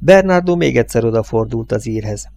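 usual speaking voice

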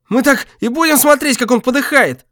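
gasping, high and loud; breathless. Acted. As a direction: choke the words out breathless. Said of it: "задыхающимся голосом спросил Гюрза".

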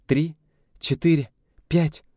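counting out, rising in pitch; excited countdown; loud counting. A narrator's reading. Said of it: "громко считала Настя".

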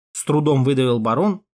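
usual speaking voice

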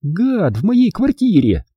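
put-on high, slow sardonic girl's voice. A narrator's reading. Hilda mocks Rick, high and slow. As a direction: bite back as the mocking girl.

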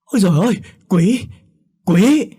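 very high, very loud shriek; terrified scream. A narrator's reading. Old woman shrieks at the maid, terrified. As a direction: scream in pure terror. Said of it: "lại hét lên thật lớn".

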